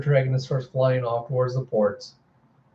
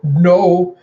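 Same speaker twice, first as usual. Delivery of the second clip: loud, higher mock scream; 4 semitones higher, 7 dB louder than usual. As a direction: cry out in thwarted protest. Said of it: "she screams out".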